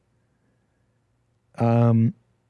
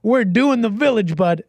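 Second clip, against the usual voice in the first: high, louder rallying call to the crew; rallying the boys; 6 semitones higher, 4 dB louder than usual. Retold or imitated. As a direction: rouse the kitchen crew for the rush. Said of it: "I'd tell the boys".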